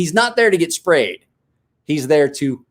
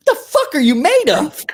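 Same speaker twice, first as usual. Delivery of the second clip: high, incredulous outburst; can't believe he's unfazed; high astonished laughing outburst; stunned disbelief. Retold or imitated. Retelling disbelief at tough kid.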